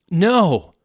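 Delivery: sudden high horrified scream. Acted. Narrator screaming in horror at the news. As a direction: shriek it in horror. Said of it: "I screamed".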